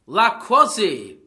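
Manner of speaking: loud, drawn-out fake French accent; mock French. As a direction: ham up a bad French accent in slow warning. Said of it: "They could have gone".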